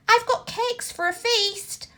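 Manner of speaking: high, bright call to coax the thief; calling George. A narrator's reading. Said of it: "and he called".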